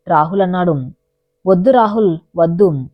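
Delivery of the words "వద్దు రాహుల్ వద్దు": plain reading voice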